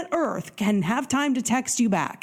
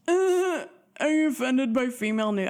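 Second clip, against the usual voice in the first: 1 mocking the date; 4 semitones higher than usual. Imitating the date's question.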